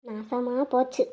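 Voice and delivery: very low, hushed grumble; dismayed aside. Acted. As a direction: say it under your breath, dropping right down. Dismayed aside as complaint spreads. (தனக்குத்தானே தாழ்ந்த குரலில் அலுத்துக்கொள்ளுதல்)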